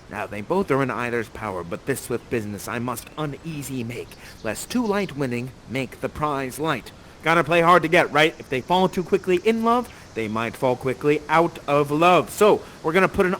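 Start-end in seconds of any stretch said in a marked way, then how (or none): 0.1-6.9 low, soft verse aside; conspiratorial aside. Acted. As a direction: confide the scheme under your breath. Prospero's scheming aside in verse.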